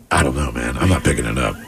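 very low, quiet refusal; low stoned mumble. Retold or imitated. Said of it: "You know".

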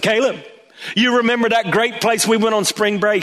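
dad hyping up a fun memory; coaxing dad. Retold or imitated. Dad gently coaxing son.

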